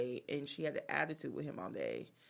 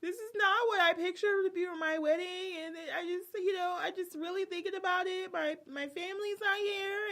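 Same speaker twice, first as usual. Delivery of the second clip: high, crying-voiced mock; mock tearful bride; 13 semitones higher, 5 dB louder than usual. Bride dressing in restaurant bathroom, mock tearful. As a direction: whimper it out, pitched high. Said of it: "that's when it all hit her, like".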